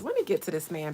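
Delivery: usual speaking voice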